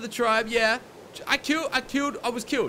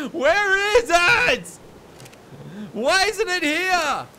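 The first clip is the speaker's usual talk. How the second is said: higher, louder and slow; frantic outcry; 6 semitones higher, 5 dB louder, much slower than usual. Real, not acted. Player finding the loot gone, frantic and loud. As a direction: cry out for the missing body.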